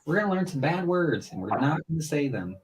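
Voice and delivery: high, sing-out classroom voice; teacher. Retold or imitated. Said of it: "I was just hoping the teacher".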